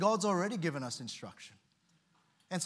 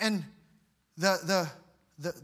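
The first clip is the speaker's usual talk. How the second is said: usual speaking voice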